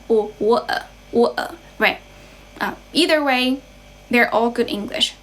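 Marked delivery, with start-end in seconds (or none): 0.4-1.6 British "water" with the t dropped; glottal-stop British "wa'er", no final r; British accent. Shown as a demonstration. Teacher showing another variant of water, British accent. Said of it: "in British English".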